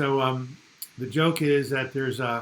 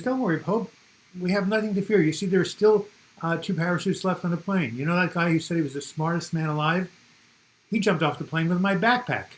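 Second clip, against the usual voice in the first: put-on higher, eager kid's voice; ten-year-old boy voice; 4 semitones higher, 4 dB louder than usual. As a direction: cheerfully tell the Pope the good news like a boy. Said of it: "The boy says".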